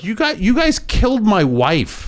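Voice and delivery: high, drawn-out accusing outburst; retold outrage, pitched well up; mock outraged accusation. Imitating Joe Kent blaming officials for his wife's death, outraged.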